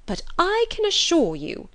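high, loud scandalised complaint; bitter indignation. Acted. Mother complaining of Darcy to husband, indignant. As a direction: huff with exaggerated outrage. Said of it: "with much bitterness of spirit and some exaggeration".

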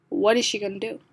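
ordinary delivery, nothing marked

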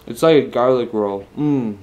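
eating between words; talking while chewing. Real, not acted. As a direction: mouth partly full of bread. Seen 0: Holds bitten roll, mouth working between words; hidden by roll later.

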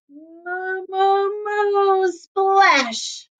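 high playful moos; mooing cow. Acted. Cow runs past Duck and dives, high mooing. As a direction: moo your way into the splash.

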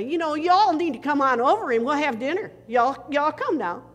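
loud, high, hearty Oklahoma Southern invitation; Southern drawl slip. Retold or imitated. Pastor back north slipping into Oklahoma Southern talk, loud and high. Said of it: "I would slip up and say".

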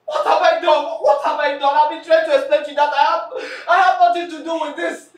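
shouting in hurt disbelief; loud, wounded accusation; furious shout. Acted. Confronting the friend who attacked her, shouting furiously.

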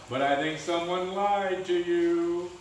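drawn out with dread; ominous. Acted. Warning friends about a lie.

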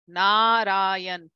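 Narayan with a long first A; correct Sanskrit. Shown as a demonstration. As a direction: hold the A in Narayan. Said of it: "this A here is long".

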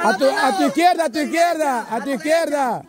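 high, insistent directions; urgent guiding call. Real, not acted. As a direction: steer the blindfolded player urgently. Guiding blindfolded player left. (indicaciones agudas e insistentes)

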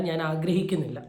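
ordinary delivery

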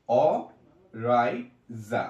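Oryza drawn out slowly, syllable by syllable; broken into syllables. Shown as a demonstration. Teacher breaking the name apart for students, slowly. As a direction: stretch Oryza into its separate syllables. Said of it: "প্রথমে আমরা ভেঙে ভেঙে পড়বো".

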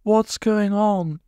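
high, very slow weak voice; weak woman. A narrator's reading. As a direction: ask it weakly.

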